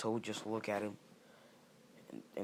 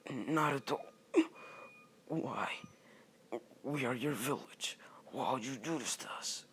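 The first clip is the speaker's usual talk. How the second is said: acted anguish, a little higher; wounded plea; 3 semitones higher than usual. Hiruzen asking Naruto about his betrayal, wounded plea. Village leader pleads with Naruto.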